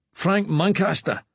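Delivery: Scottish brogue, friendly query; broad Scottish accent. Acted. Attendant greeting a patient, broad Scottish accent. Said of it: "in a broad Scottish accent".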